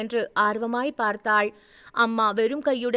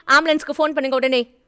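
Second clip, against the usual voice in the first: urgent; 3 semitones higher, 6 dB louder, faster than usual. A narrator's reading. Urgent order in emergency.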